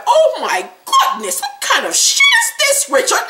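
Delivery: hammed-up scandalized gasping voice with laughter; mock shock. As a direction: imitate someone appalled, stretching the words up high. Imitates Tiffany shocked walking in.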